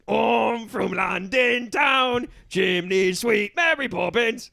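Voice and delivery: drawn-out hammed-up Cockney; slow, proud chimney-sweep Cockney; London accent. Driver defending his accent to passenger, broad slow Cockney. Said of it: "what accent is".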